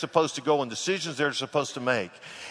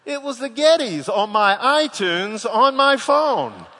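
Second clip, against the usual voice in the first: ordinary talk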